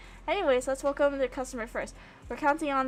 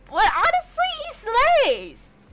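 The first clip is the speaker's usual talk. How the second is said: high, loud gushing praise; fangirling; 12 semitones higher, 6 dB louder, slower than usual. Real, not acted. Streamer defending a favourite character's redesign, high excited gushing. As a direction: gush like a fan. Fangirling over Harry's model.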